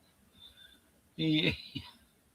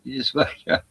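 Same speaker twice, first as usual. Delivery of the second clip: normal talking voice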